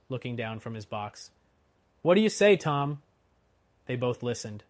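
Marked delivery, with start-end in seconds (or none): 2.0-3.0 loud, high question; urgent calling. A narrator's reading. Coachman asks the guard, urgent raised call.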